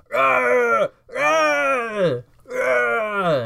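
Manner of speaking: big scary roar to frighten them; monster roar. Acted. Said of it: "make myself big and scary and, like, just kinda yell at them".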